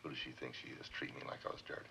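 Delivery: bitter hushed whisper. Acted. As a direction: hiss it under your breath. Spiteful aside about her.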